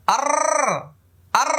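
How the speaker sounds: র curled and full, lips unrounded; full-mouthed র demo; heavy Arabic ra. Shown as a demonstration. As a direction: roll a thick র. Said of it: "এই যে একটা আওয়াজ করেছে".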